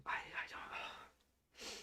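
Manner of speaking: breathless, slow, barely voiced; words fail, nearly silent; whispered loss for words. Real, not acted. Fan at a loss for words, whispered and slow.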